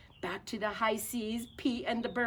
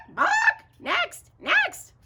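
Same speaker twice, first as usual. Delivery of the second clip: very high squawking bird voice; parrot squawk; 11 dB louder, much slower than usual. Acted. The parrot squawking at the rascals, very high and loud. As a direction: screech it like a parrot. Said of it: "said the parrot".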